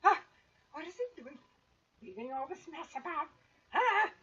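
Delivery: high-pitched grumbling little mongrelfolk voice; high muttered grumble with sharp "Ha!"s; muttering to himself. Acted. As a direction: grumble to yourself in a high character voice. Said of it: "he mutters to himself".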